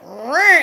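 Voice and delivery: loud mock growl. Rowan snapping at Olivia, a loud mock growl. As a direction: growl it like an angry dad. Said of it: "he just was like".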